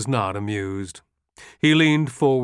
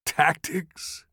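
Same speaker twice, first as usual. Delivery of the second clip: chuckling; 5 semitones higher than usual. A narrator's reading. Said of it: "he chuckled".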